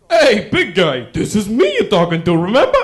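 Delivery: a hint of a cocky old man; cocky grandfather. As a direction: talk him down, smug. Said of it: "first guy ever to say".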